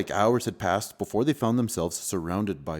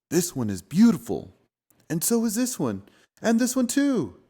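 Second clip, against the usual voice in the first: wonder; 11 semitones higher than usual. Acted. Wonder building at snowflakes.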